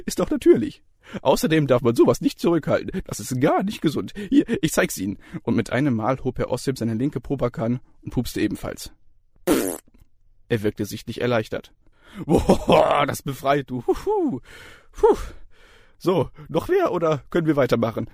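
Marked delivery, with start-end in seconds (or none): 0.1-5.2 jovial lecturer. A narrator's reading. Lecturer opens the exam, cheery and high. 12.3-18.1 higher, gleeful relieved voice; relieved cheerful lecturer. A narrator's reading. Lecturer relieved after farting, gleeful. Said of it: "fragte er vergnügt".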